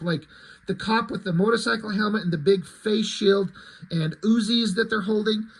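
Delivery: normal talking voice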